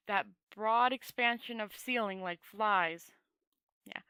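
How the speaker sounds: ordinary delivery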